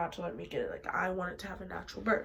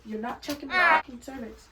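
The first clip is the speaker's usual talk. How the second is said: higher, louder mock yell; retold screaming; 5 semitones higher, 6 dB louder than usual. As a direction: act out a yelled refusal.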